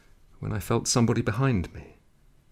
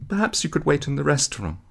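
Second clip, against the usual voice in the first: fast, higher nervous babble; babbling; 7 semitones higher, 5 dB louder, faster than usual. A narrator's reading. Flustered chambermaid babbling to the new guest, fast. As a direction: rattle it off, flustered. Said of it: "she babbled".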